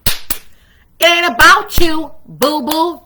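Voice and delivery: loud, drawn-out sassy comeback; acted put-down, stretched out for sass; sassy snapback. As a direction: snap back with slow, heavy attitude.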